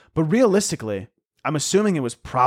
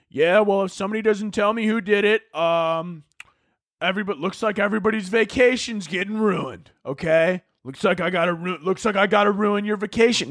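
higher-pitched mock scolding; imitated peeved pilot; 6 semitones higher than usual. Pilot threatening everybody's vacation, imitated peeved scolding. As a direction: put on a raised, fed-up announcing voice.

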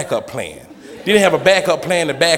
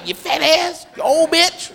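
mock angry old lady; 13 semitones higher, slower than usual. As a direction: curse him out high. Old lady cursing, acted.

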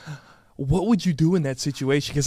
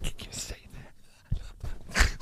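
whispering; 9 dB quieter than usual. Real, not acted. Whispered aside.

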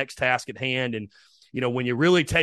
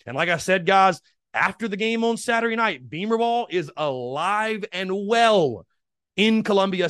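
high, punchy promo pitch for the sale; pumped-up sponsor read, stretching the key words; hyped ad read; 5 semitones higher than usual. Acted. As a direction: sell it like a radio spot, big and bright.

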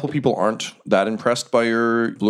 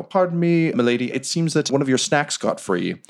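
higher-pitched deferential servant voice; obsequious server, raised pitch; 6 semitones higher than usual. Acted. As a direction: politely alert milady, pitched up. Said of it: "one of the servers go".

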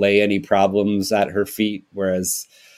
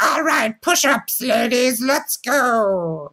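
much higher barked orders; drill-sergeant matron; much slower than usual. Pretend. Care-home staff ordering the mothers around once family leaves, much higher and barking. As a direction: bark it like a gym drill sergeant. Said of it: "they're gonna be like".

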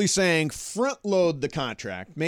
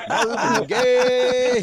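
laughing; 5 semitones higher, 4 dB louder, much slower than usual. Real, not acted. Host laughing at comparison.

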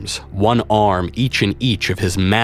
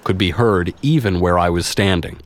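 usual speaking voice

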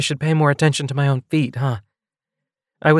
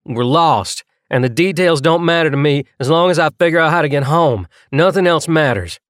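put-on raised-voice outburst, higher and heavily accented; impassioned, louder, building insistence; louder, thick accent; 6 semitones higher, 5 dB louder than usual. A narrator's reading. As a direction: let it burst out loudly with a heavy accent. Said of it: "His voice had gotten louder".

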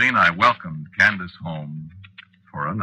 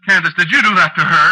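shocked; 10 semitones higher, 7 dB louder than usual. Acted. Husband horrified by the transformation.

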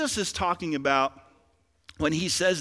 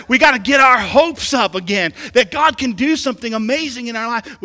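loud preacher building in force; impassioned preaching; 5 semitones higher, 9 dB louder than usual. Real, not acted. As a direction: rouse the church to raise its hopes.

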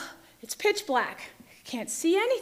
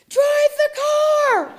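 high, loud, drawn-out yell; piercing command from inside the head; yelling inner voice; 13 semitones higher, 9 dB louder, much slower than usual. Retold or imitated. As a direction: scream the order slowly and urgently. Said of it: "this voice yells in my head".

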